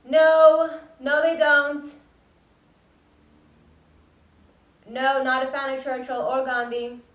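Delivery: loud, high, exasperated answers; shouting through walls. Acted. Answering the neighbor's questions through the wall, exasperated shouting. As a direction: holler each answer so it carries next door.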